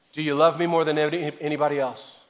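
usual speaking voice

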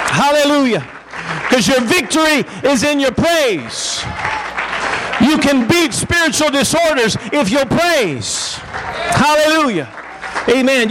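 rising, fervent preaching. Acted. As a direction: preach it to the congregation. Preaching at full force.

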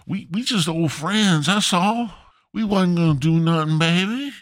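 put-on high, whiny pleading to his baby; pleading with the girlfriend, high and whiny. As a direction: beg her to believe him.

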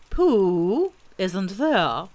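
very slow, loud owl report; ponderous owl announcing news; pompous Owl. A narrator's reading. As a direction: draw out the name, then report gravely.

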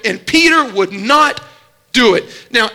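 normal talking voice

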